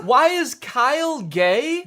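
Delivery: high, loud, slow disbelief; incredulous mock repeat. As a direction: echo it back loudly, stretched in mock outrage. Mocking repeat of clip's line.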